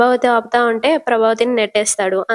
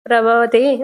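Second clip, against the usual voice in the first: plain reading voice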